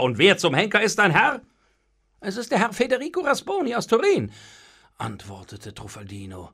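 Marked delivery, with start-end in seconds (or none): 0.0-1.4 loud, exasperated demand; annoyed. A narrator's reading. Master to the evasive servant, annoyed. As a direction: grab the servant with the question. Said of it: "packte ihn ärgerlich an der Schulter".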